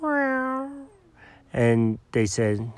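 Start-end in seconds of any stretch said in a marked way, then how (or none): 0.0-0.7 high soft meow; cat meow. Acted. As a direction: meow sweetly like an innocent cat. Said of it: "Jenkins looked at him and went".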